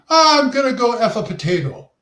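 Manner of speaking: high, hammed-up stage Irish accent. Shown as a demonstration. Gamer demonstrating his friend's joke accent, in stage Irish. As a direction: go big with a cartoon Irish brogue. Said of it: "That's a stage Irish".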